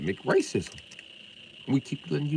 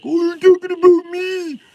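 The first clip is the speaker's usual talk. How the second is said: much higher, louder, slow whiny mock voice; 11 dB louder than usual.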